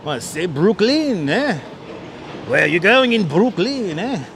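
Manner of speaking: slow, hammed-up French-Canadian accent with a tagged-on "eh". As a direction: drawl it out like a mock Quebec visitor. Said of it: "I guess that's a French accent".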